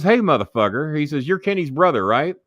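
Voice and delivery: confrontational. Retold or imitated. Acted confrontation of scared brother.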